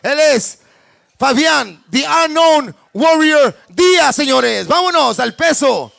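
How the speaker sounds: loud Spanish fight-night intro; lucha libre announcer. Real, not acted. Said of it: "lucha libre announcer".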